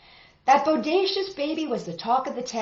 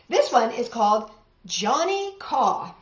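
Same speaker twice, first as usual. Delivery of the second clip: plain reading voice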